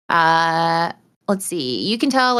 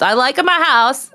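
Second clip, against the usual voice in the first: slow, louder Borat accent, voiced higher; Borat impression; 4 semitones higher, 4 dB louder, slower than usual. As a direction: stretch the words out slowly in a mock Kazakh accent. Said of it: "That's Borat".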